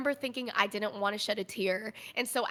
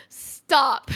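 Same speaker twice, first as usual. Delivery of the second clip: firm stop; 3 semitones higher, 9 dB louder than usual. Retold or imitated. Telling him to stop.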